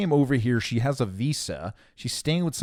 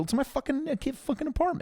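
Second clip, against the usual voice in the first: mock indignant squeak; 14 semitones higher, 4 dB quieter than usual. Indignant retort, retold.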